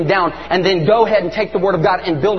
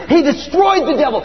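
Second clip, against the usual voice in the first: raised, higher, preaching proclamation; forceful declaration; 6 semitones higher than usual. Retold or imitated. Parent re-enacting prayer over a son, forceful declaration. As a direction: declare it like a battle cry.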